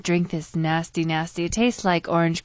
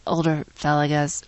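ordinary talk

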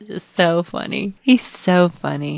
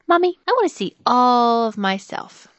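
put-on child's voice; 5 semitones higher than usual.

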